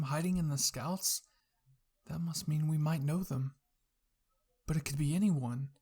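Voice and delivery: plain reading voice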